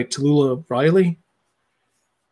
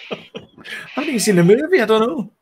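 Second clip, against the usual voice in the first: laughing; 8 semitones higher than usual. Real, not acted. Laughing after creepy voice.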